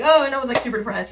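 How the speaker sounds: lightly imitating her own glossing over; breezy dismissive. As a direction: toss it off like it was nothing. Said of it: "I'll be like".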